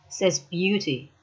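ordinary delivery, nothing marked

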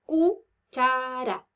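"cuchara" slowly divided into cu, cha, ra; "cuchara" counted out in slow beats; syllable by syllable. Shown as a demonstration. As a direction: draw out and separate each syllable of "cuchara". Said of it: "Vamos a contar cuántas sílabas".

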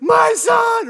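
very high, loud scream; frantic shout. Acted. Mom cycling into panic for lost son, screaming. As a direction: shriek it out frantically. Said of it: "She starts".

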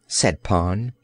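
usual speaking voice

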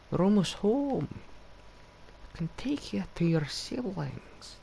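slow, lilting mock Scottish voice; Scottish accent. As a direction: coax the pet along in a playful Scottish accent. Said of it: "Don't know why I'm going Scottish".

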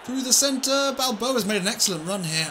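high, loud gasping call; excited commentary. Real, not acted. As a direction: shout the goal-line clearance in disbelief. Goal-line clearance commentary.

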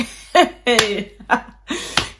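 bursting out laughing; a big real laugh; loud amused laugh. Real, not acted. Laughs at sister's phrase.

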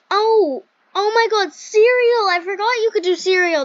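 high, loud burst of delight; excited surprise. Real, not acted. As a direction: exclaim a sudden happy discovery, high and loud. Sudden delighted discovery of cereal.

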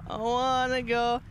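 slow, wailing scared moan; long, miserable frightened wail; drawn-out wail. Real, not acted. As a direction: stretch the vowels into a wobbling whine. Scared player moaning to leave.

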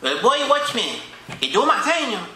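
put-on lightly held Vincentian accent, chatty; a Caribbean cab driver holding court; Vincentian taxi driver. Taxi driver character skit.

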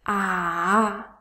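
"ah" low, dipping, then rising; third tone. Shown as a demonstration. Teacher reviews the tones, low dipping "ah". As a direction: dip "ah" down and turn it up. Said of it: "Let's review the tones".

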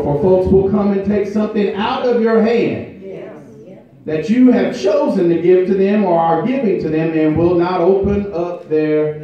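high, loud, rhythmic preaching with held notes; full-force preaching. Acted. Pastor urging the congregation to say thank you, preaching at full force. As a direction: build it up and drive it home to the church.

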